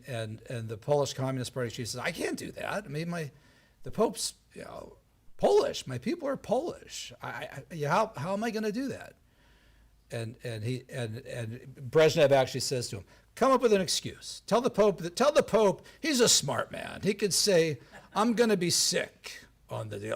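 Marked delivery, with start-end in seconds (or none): 2.0-9.1 flustered pleading. Retold or imitated. Speaker plays Polish party chief balking at Brezhnev's order, flustered. 13.3-20.1 tag. Retold or imitated. Acting Brezhnev pressing an excuse.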